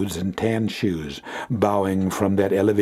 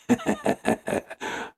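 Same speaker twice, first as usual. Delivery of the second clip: a light, airy giggle up high; breathy high laugh; 4 dB quieter than usual. A narrator's reading. Two men giddy over good news, breathy laugh.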